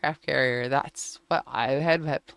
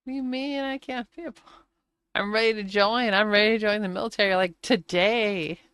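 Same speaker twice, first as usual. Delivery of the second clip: high, fast and louder, eager recruit; eager insistence; 6 semitones higher, 5 dB louder than usual. Retold or imitated. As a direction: push to enlist today. Eager teen insisting on joining.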